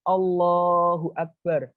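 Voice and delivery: long drawn-out, exalting takbir; reverent glorifying. Shown as a demonstration. Teacher shows how to glorify God in prayer, reverent and drawn-out. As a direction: stretch out the name of God in reverence. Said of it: "Lek kita mengucapkan pengagungan".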